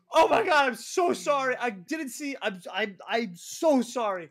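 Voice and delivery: high, loud flustered apology; panicked apology. Retold or imitated. Host caught in the women's bathroom, loud panicked apology. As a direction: babble sorry in a panic. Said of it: "And I say".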